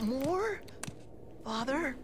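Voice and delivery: high pleading question; pleading whine. Acted. Mike pleads for more pizza.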